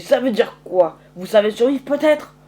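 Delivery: loud, confrontational questions; indignant challenge. Acted. Farmer challenges Curtis in quarrel.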